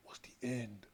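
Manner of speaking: hushed, heavy whisper on the end; dramatic whisper. Real, not acted. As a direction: drop to a whisper for the final verdict. Dramatic pause before the verdict.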